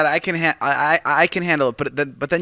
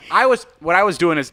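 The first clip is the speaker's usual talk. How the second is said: ordinary delivery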